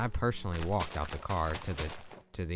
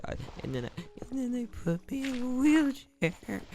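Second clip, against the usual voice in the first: high, wailing mock sob, much higher; imitating bawling. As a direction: replay the drugged crying fit, voice cracking up high. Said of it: "I just started bawling, crying".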